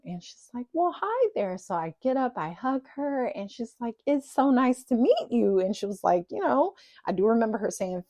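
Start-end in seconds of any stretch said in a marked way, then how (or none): 0.7-1.6 high-pitched Texas belle greeting; Southern belle drawl. Retold or imitated. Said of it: "she's got this long, drawn-out accent". 4.1-5.6 higher, louder polite Texas belle; Southern belle drawl. Retold or imitated. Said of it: "she's got this long, drawn-out accent".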